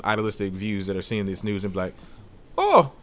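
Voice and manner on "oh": mock German accent, pitched up; terrible German accent. Podcaster imagining Germans reacting to the news, terrible German accent. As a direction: put on a bad German accent. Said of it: "That is a terrible accent".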